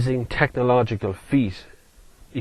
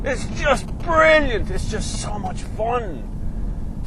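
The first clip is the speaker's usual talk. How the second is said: high, slow, savouring every word; elated; 8 semitones higher, 4 dB louder, much slower than usual. Real, not acted. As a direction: stretch the words out in delight. Delight after the acceleration.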